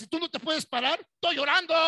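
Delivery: loud, high, wailing at her husband; hammed-up tearful wife yelling; mock crying yell. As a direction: shout it like a sobbing wife. Wife yelling in acted skit.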